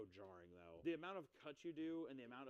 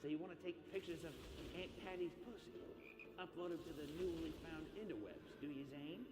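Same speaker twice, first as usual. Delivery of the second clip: higher, leering puppet taunt; lightly pitched creepy puppet; creepy dummy voice; 5 semitones higher than usual. Retold or imitated. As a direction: menace the boy like a sleazy dummy. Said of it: "He's like, says some creepy shit".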